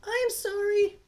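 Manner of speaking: high, gentle princess voice; Nintendo princess voice. Acted. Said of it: "every Nintendo Princess voice".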